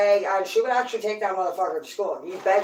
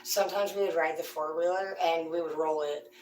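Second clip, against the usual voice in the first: usual speaking voice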